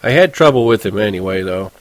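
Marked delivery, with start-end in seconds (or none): none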